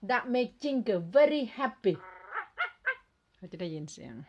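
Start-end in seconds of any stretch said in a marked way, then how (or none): 0.1-1.9 higher and louder, very slow, very punched; loud slow clear English. Shown as a demonstration. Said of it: "ต้องพูด ภาษาให้ชัดนะคะ".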